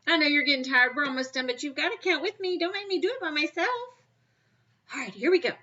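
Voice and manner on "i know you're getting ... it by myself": higher-pitched, lively coaxing; rally flagging helpers with a rising plea; playful pleading. Real, not acted. Teacher urging tired children to count with her, playful pleading.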